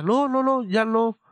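quick nervous denial in her voice; voicing the sister. Sister answering nervously in retold scene.

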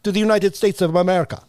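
high-pitched oratory in a put-on Filipino accent; Filipino father's accent. Son acting out his father's speech to the post, Filipino accent. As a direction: play a Filipino-accented veteran addressing his friends. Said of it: "That's the accent?".

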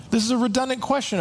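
emphatic repeat. Shown as a demonstration.

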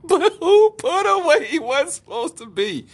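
high, loud, drawn-out wail of disbelief; exasperated squeal, stretched out; laughing incredulity. Real, not acted. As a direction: wail the question, amused and baffled. Incredulous ranting about the story.

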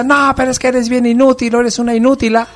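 mother calling her son useless, mock shout; mock scolding mom. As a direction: scold like an exasperated mom, hammed-up. Mom insulting the son, acted.

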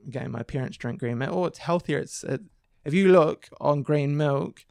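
usual speaking voice

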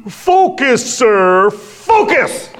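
high, loud barked order; drill-instructor yell. Retold or imitated. Young Marine at the water stop yelling at the flagging runner, loud. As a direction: snap him back like a drill sergeant.

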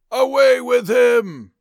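loud, high, slow mob yell. Acted. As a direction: bellow it at the crowd's front. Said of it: "they yelled".

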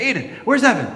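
high-pitched urgent question, re-enacted; alarmed dad. Dad finds younger son gone, alarmed questioning. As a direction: demand an answer from your child.

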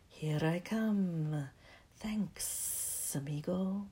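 slow, hissed snake whisper; a lightly voiced snake, hissing; low hissing snake. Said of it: "a low, hissing voice said".

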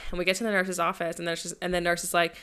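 usual speaking voice

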